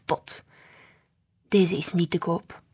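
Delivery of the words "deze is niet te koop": understated low grumble; grumbling grandpa. A narrator's reading. Grandpa refusing to sell, mildly grumbling. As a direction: grumble it lightly, holding firm. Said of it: "bromde hij".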